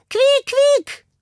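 very high rodent squeals; hamster squeaking. A narrator's reading. Hamster demonstrating animal language, high squeaking. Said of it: "machte der Hamster".

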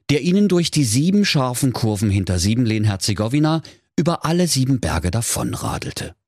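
usual speaking voice

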